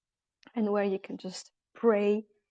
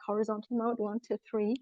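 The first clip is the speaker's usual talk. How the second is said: ordinary talk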